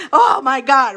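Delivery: loud, laughing exclamation; laughing oh my God. Retold or imitated. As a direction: laugh the words out. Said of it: "I'm like".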